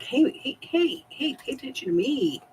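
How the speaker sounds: high squeaky pet voice, pleading; voicing the cat. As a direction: play the needy cat begging for attention. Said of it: "He's like".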